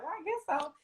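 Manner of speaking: high-pitched, soft mock shrug; imitating a tentative yes; reluctant agreement. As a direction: go way up and small, half-convinced. Voicing own hesitant acceptance.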